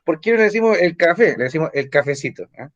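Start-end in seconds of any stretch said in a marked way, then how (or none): none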